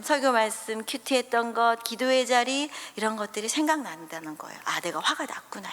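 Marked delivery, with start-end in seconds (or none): 4.6-5.7 속삭이는 혼잣말. Shown as a demonstration. 감정을 알아차리는 독백.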